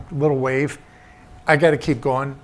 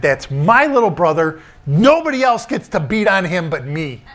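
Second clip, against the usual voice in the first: a mock big-brother yell, slightly louder; retold yell; 4 semitones higher, 6 dB louder than usual. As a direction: bark it out like a protective big brother. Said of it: "He yelled at him".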